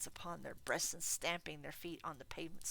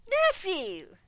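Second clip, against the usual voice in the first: loud, high stern rebuke; 14 semitones higher, 15 dB louder than usual. Acted. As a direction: cut him off sternly. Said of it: "returned the uncle sternly".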